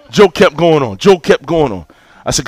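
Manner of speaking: ordinary talk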